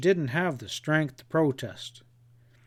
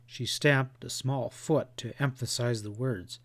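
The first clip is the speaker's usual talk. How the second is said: ordinary delivery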